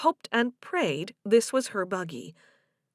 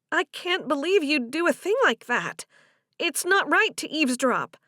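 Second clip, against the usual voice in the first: higher-pitched woman, taking offence; indignant Delia; 7 semitones higher, 4 dB louder than usual. Acted. Delia scolds Joe for eavesdropping, indignant. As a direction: scold him in a raised pitch.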